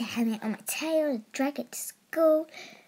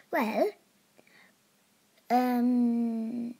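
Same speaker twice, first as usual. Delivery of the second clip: usual speaking voice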